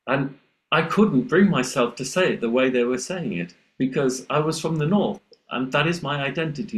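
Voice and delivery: ordinary talk, nothing marked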